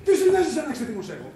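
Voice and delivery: high, loud, exasperated shouting; still angry. Acted. Boss to Manolis checking whether his anger has passed, angry. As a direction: let the temper burst out at him. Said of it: "Να δω αν ξεθύμωσες".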